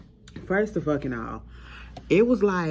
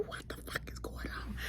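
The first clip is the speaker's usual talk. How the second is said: whisper; 16 dB quieter than usual. Retold or imitated. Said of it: "I said".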